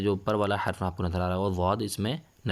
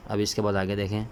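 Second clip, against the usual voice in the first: ordinary talk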